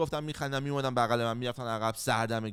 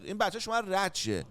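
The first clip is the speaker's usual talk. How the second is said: ordinary talk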